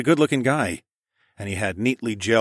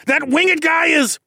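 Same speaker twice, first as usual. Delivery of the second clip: high, loud, slowing panicked yell; frantic shout; 8 dB louder than usual. A narrator's reading. Narrator yelling at Kat while the dino closes in. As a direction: shout it, desperate.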